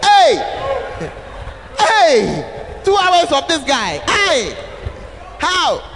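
high, loud mock protest; car shout. Friends in the car shouting at the boring host. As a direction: cry out Hey in disbelief. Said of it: "we shouted".

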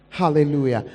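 normal talking voice

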